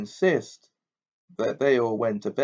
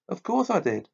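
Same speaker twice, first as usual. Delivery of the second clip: strangely high, tight voice; high-pitched; 7 semitones higher than usual. A narrator's reading. Hermione answering Harry without looking up, high-pitched. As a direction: keep it high and strained. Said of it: "in a strangely high-pitched voice".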